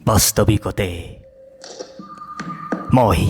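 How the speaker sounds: poetry recitation. Acted. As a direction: build lists into high-pitched declamation, pausing between phrases. Poem recitation.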